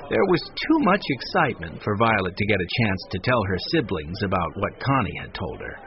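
ordinary delivery, nothing marked

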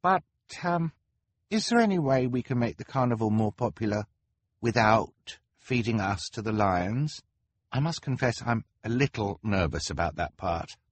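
high, halting, nervous voice; hesitant. A narrator's reading. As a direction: timidly plead to be spared. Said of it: "Hugo said hesitantly".

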